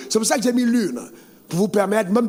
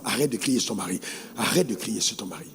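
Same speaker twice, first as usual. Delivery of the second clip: softly repeating the pastor's words; 11 semitones lower, 7 dB quieter than usual. Shown as a demonstration. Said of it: "Dis-le à la femme".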